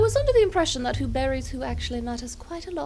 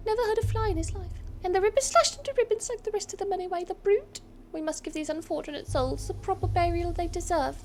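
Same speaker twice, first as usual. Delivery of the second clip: a bit higher, grieving townsfolk voice; lightly voiced townsperson; 7 semitones higher than usual. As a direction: read it as a mourning villager. Voicing an NPC, higher.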